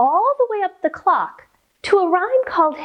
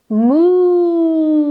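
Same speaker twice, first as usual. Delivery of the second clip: cow moo; 3 semitones higher, 4 dB louder than usual. Shown as a demonstration. Teacher leads kids in a yoga cow moo. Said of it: "Let's say moo".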